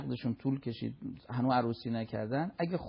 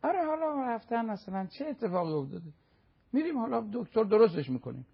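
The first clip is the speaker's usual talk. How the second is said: high, airy dismissive voice; mock nonchalance; 7 semitones higher than usual. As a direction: imitate people brushing it off.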